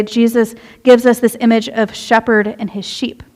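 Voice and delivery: ordinary talk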